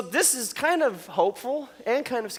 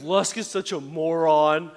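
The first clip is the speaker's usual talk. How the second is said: mock kid insult; 3 semitones lower, slower than usual. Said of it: "He goes".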